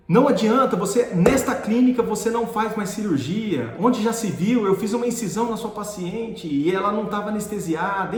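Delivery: acting out an outraged professor; retold angry tirade. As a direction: tear into the student. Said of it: "Enfim, falou comigo um monte".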